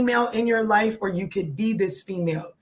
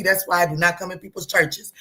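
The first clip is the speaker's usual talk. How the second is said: ordinary delivery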